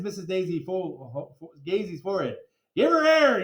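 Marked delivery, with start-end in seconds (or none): 2.8-3.4 high, loud shouted order. A narrator's reading. Principal shouts at kids to give her air, loud. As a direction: bark it out to the crowd. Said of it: "he shouted".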